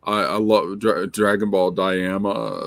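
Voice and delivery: lightly voiced comic reviewer; a hint of a nerdy reviewer voice; Ethan Van Sciver impression. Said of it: "I can do some voice".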